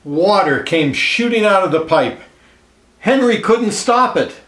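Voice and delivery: lively read-aloud storyteller voice, clear and even; storybook narration. A narrator's reading. Reading a picture book aloud to children, lively storyteller voice. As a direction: tell it like a picture book for kids.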